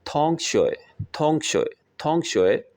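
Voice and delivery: थोंग high, शोए dropping away, repeated evenly; Chinese pronunciation demo. Shown as a demonstration. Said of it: "जैसे classmate को कहता है जी". (थोंग ऊँचे सुर में, शोए अलग से)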